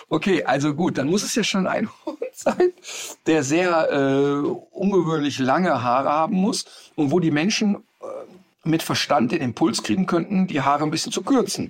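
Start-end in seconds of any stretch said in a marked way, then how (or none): none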